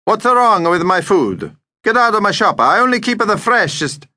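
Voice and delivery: put-on high, indignant accented shopkeeper. Deli owner orders a rude customer out, indignant.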